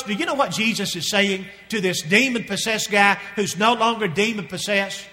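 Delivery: ordinary talk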